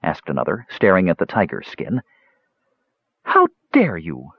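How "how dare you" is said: slow, outraged exclamation; offended outburst, not shouted; indignant cry. A narrator's reading. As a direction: draw out each word in affront. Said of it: "cried the blonde".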